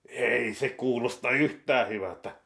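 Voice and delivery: normal talking voice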